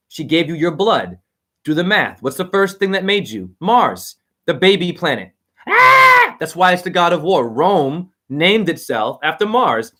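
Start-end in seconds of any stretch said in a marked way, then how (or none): 5.7-6.4 shrill-pitched loud cry out; startled high shout; sudden high yell. Real, not acted. Sudden outburst in rant.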